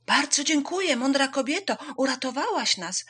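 high, loud hare voice; grateful calling out. A narrator's reading. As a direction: call out excited thanks. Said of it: "zawołał zając".

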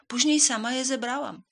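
indignant hen. A narrator's reading. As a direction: declare it loud and high as the hen. Hen proudly lists her work.

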